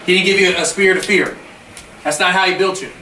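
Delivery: loud, low fired-up coach; halftime pep speech. Real, not acted. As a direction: preach it to the team at full force.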